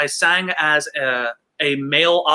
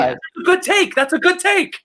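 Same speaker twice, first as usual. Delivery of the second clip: mock director; 14 semitones higher than usual. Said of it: "mimicking the director".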